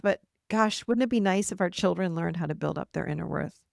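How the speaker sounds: ordinary talk, nothing marked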